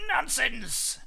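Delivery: high, loud dismissal; indignant mother dinosaur; scolding. A narrator's reading. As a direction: scold the frightened children. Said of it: "His mother scolded".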